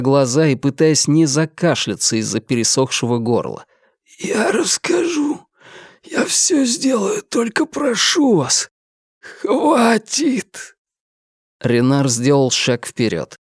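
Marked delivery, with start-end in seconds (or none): 4.2-10.8 broken begging. Acted. Сломленный пленник сдаётся.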